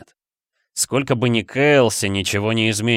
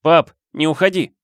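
calling after his dad, louder; 6 semitones higher, 4 dB louder than usual. A narrator's reading. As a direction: call out, pleading him to stay. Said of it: "позвал взрослый Флинн".

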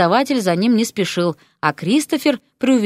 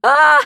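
high, loud startled yelp; startled squeal; 7 semitones higher, 6 dB louder than usual. A narrator's reading. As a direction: squeal in shock. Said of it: "взвизгнула опешившая Мартина".

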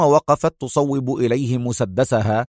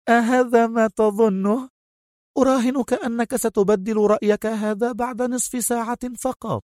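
higher voiced, icy and taunting; a female villain coolly mocking him; cold villainess; 11 semitones higher than usual. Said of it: "في برود". (ببرود ساخر)

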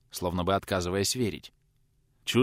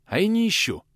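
sudden higher defiant retort; 11 semitones higher, 4 dB louder than usual. A narrator's reading. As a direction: snap back boldly. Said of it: "Неожиданно дерзко".

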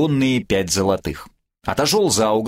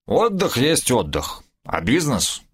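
put-on slow, slurred drunken reminder; tongue tangled with drink; drunk slurring; 2 semitones lower, slower than usual. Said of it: "заплетающимся языком напомнил".

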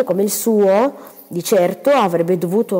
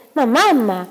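protesting daughter; 6 semitones higher, 4 dB louder than usual. Acted. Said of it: "protestò Cinzia".

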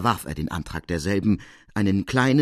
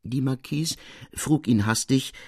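usual speaking voice

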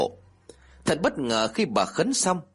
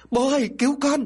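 put-on much higher child's yell; screamed cry for help; 13 semitones higher, 3 dB louder than usual. A narrator's reading. Child in the pond screaming to father, desperate cry. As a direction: cry out for rescue, pitch way up. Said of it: "tiếng của một đứa liền hét lên".

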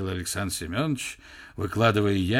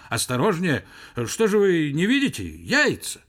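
high, louder cry of alarm; alarmed warning; 5 semitones higher, 5 dB louder than usual. A narrator's reading. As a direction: snap at someone to be careful. Warns guard handling the eggs.

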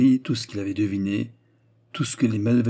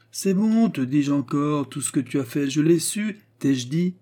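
high-pitched, exasperated insistence; vexed old father pressing on; impatient insistence; 5 semitones higher than usual. Acted. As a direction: override the protest, voice pitched up. Father insists over son's protest.

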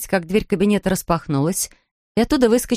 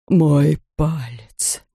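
very slow, low, separated words; deliberate, drawn-out low delivery; slow and distinct; 4 semitones lower, much slower than usual. A narrator's reading. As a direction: space out each word in a deep voice. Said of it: "медленно и раздельно произнёс он".

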